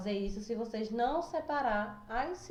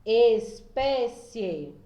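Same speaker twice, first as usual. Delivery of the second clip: slow, louder ES-PÉ-CIE with each syllable held; split into syllables; 6 dB louder, much slower than usual. Shown as a demonstration. Teacher dividing the example word for the class, syllable by syllable. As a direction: break "Espécie" into long, separate syllables. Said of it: "eu tenho que fazer a divisão dela".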